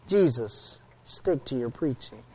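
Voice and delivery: very soft muttered retort under the breath; a quiet grumble to himself; muttered aside. Retold or imitated. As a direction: drop almost to nothing, grumbling. Muttered punchline of Peter.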